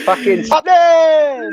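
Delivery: high, loud, drawn-out holler; shouted catchphrase. Real, not acted. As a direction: belt out the sign-off, stretching the word.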